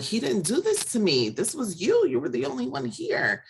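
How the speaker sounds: high-pitched, distressed retelling without shouting; retold crying protest. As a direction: re-enact an upset, accusing plea. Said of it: "I'm crying, screaming".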